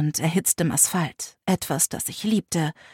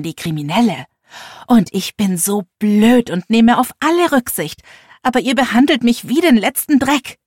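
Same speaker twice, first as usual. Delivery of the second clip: loud, higher furious tirade; yelling in anger; 3 semitones higher, 5 dB louder than usual. Acted. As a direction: scream every grievance at her. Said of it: "Ich schrie meiner Mutter alles entgegen".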